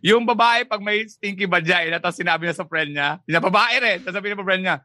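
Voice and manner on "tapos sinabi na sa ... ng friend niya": ordinary talk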